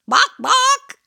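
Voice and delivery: high, loud clucking hen sounds; chicken clucks. Pretend. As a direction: cluck it out like a playful hen for the kids. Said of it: "What does a chicken say".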